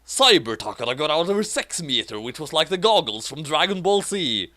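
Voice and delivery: ordinary talk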